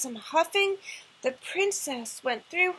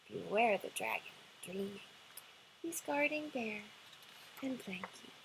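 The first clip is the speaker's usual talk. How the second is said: lower, softer goodnight; reading the last lines to a sleepy child, softer and lower; bedtime fade-out; 5 semitones lower, 6 dB quieter than usual. Acted. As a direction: wind it down to sleep. Bedtime ending fades down.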